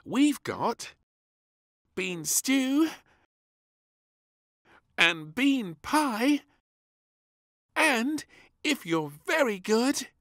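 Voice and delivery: high, bossy little hostess; princess hosting tea party. Acted. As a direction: play hostess to the dolls. Princess serving dolls tea.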